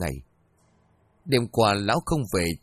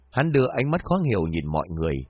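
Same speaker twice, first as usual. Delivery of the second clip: ordinary delivery, nothing marked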